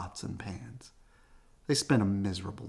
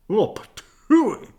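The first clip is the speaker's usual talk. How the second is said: spitting patooey; 11 semitones higher, 10 dB louder, slower than usual. Acted. Papa spits stew.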